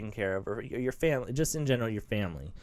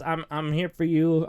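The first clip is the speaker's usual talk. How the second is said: ordinary delivery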